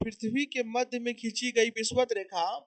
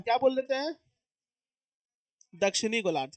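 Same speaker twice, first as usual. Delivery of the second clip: ordinary talk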